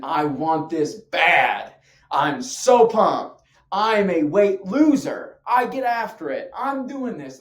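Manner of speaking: loud, pumped-up self-hype; high emotion. Acted. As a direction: punch out each claim with fierce drive. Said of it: "High emotion, as in".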